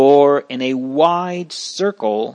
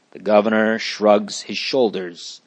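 ordinary delivery

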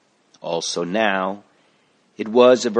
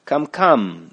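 higher, louder coaxing; encouraging; 5 semitones higher, 5 dB louder than usual. Acted. Doctor coaxing the sick boy to take pills, encouraging. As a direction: urge the boy on kindly. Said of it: "the doctor encouraged him".

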